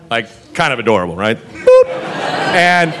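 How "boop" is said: squeaky high boop, louder; comic boop. Pretend. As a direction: pop out a cartoon boop. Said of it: "comic sound effect".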